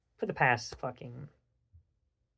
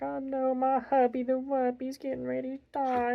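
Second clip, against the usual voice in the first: much higher, whiny hammed-up wife voice; mock crying wife; 11 semitones higher than usual. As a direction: mimic a wife wailing over her husband. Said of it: "crying and bawling and crying".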